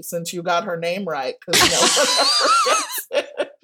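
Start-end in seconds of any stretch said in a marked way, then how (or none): 1.4-3.5 high, breaking into laughter mid-sentence; helpless laugh over the line; real laughing. Real, not acted. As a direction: laugh through the words. Joking about wife's often-mangled name.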